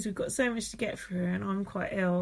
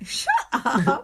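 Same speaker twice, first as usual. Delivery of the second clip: laughing, louder 'shut up'; disbelieving exclamation; 3 semitones higher, 6 dB louder than usual. Pretend. Co-host reacting to shock gossip, disbelieving exclamation.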